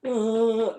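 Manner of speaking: high put-on groaning oh; mock groan. Mimicking Scouse outside work, high mock groan. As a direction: moan it like a sulking mate. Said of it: "He was going".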